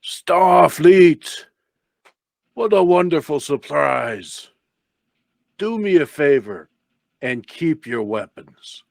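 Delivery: big booming villain voice. Acted. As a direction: boom it out like a Klingon lord. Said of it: "a booming voice".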